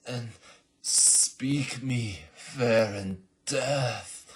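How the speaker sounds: slow, loud, high theatrical death line; dramatic dying speech. Acted. A dying assassin speaking his last words, grandly theatrical. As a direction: ham up a dying Shakespearean farewell.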